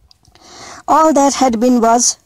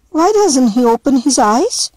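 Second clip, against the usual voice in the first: put-on little girl asking; 5 semitones higher than usual. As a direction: ask like a worried small child. Little girl Setsu voiced.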